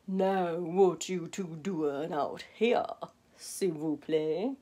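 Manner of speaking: low, slow, soft alligator; sly old gator drawl. Acted. The gator speaks.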